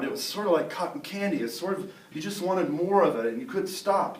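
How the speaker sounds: plain reading voice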